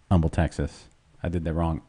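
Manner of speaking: ordinary talk, nothing marked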